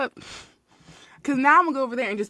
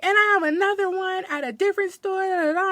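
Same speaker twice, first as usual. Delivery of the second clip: high-pitched mock complaint; imitated cracking voice; 9 semitones higher than usual. Customer arguing about another store, imitated cracking voice. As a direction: mimic a whining customer, pitched way up. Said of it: "I hear her voice cracking".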